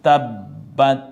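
loud, slow তাব্বাত split as tab-bat; deliberate, emphatic তাব্বাত with a held double b; recited syllable by syllable. Shown as a demonstration. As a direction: hit both syllables of তাব্বাত hard. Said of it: "আমরা চলে যাচ্ছি সোজা তিলাওয়াতে".

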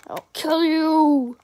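slow, put-on menacing voice; mock threat. Kid threatening a game enemy, slow mock menace. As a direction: drag out a playful villain threat.

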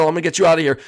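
usual speaking voice

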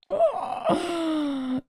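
long, high-pitched gasp. Real, not acted. Player panicking over the timed build, long high gasp. Time pressure in building challenge.

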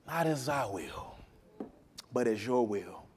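soft, low yielding words; quiet surrender. Acted. Jesus accepting the Father's will, quiet surrender. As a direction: speak it as gentle submission.

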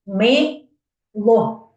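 "Мило" as two separate beats; syllable by syllable. Shown as a demonstration. Teacher and children counting, "Мило" syllable by syllable. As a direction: sound out Ми, then ло. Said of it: "Давайте визначимо".

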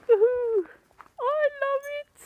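very high, squealing cheer; elated whoop. Real, not acted. Cheering look at that snow, elated high squeal. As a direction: whoop with delight.

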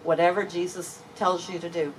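ordinary talk

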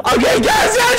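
high excited yell. Real, not acted. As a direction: shout it out, pitch way up. Repeats line, now yelling.

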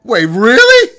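high, loud incredulous cry; startled disbelief. Real, not acted. Player caught out in the game, startled yell. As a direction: blurt it loudly in disbelief.

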